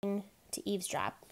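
plain reading voice

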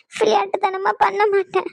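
usual speaking voice

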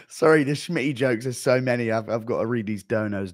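ordinary delivery

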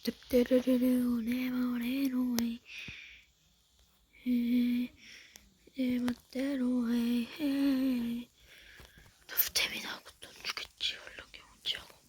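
soft, slow unvoiced mutter; whispering. Real, not acted. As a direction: whisper to yourself. Player mutters over menus.

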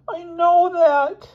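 slow, very high wail; drawn-out squeaky misery; despairing Elmer. Acted. Expelled boy to his roommate, high slow wail.

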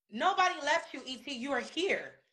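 ordinary talk

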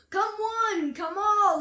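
a drumming zebra drumming up business; calling out. A narrator's reading. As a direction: call out to the crowd. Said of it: "he called".

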